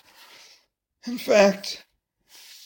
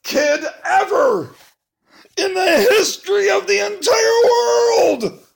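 rising higher and louder to a shout; building to a cheer; 11 semitones higher, 4 dB louder than usual. Pretend. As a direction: swell into an excited cheer. Reader gushing praise, building.

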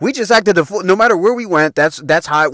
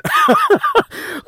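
sudden squealing laugh; high burst of laughter. Real, not acted. Host laughing at his own wild Halloween story, high squealing laugh.

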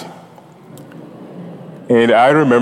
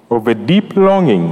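usual speaking voice

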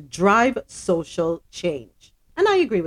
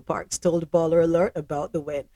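ordinary talk

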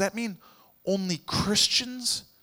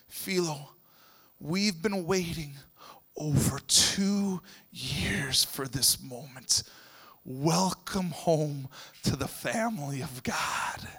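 tender welcome; 6 semitones lower, 6 dB quieter, slower than usual. Retold or imitated. Wife welcomes Philo at baptism.

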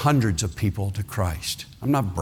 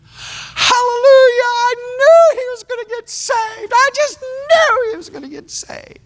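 very high, louder, tearful praise; weeping joy; 6 dB louder than usual. Retold or imitated. Joy weeping with joy after hearing the news, very high. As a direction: sob out your relief and praise.

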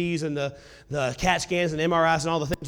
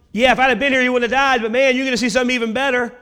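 higher, louder, hurrying into a promise; eager reassurance; 5 semitones higher, 4 dB louder, much faster than usual. Retold or imitated. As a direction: voice an insistent, excited promise. Jesus answering the doubters, acted.

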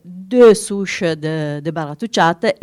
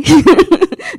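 embarrassed laugh; 11 semitones higher, 5 dB louder than usual. Real, not acted. Embarrassed at own French.

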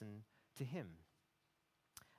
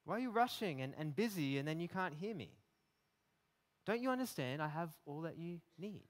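higher, urgent rebuke about time; exasperated Jesus; 6 semitones higher than usual. Retold or imitated. Jesus rebuking a busy, worried listener, higher urgent voice. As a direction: press every question home, lovingly impatient. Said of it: "He's like".

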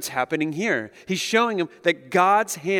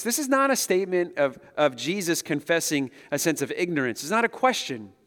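ordinary delivery

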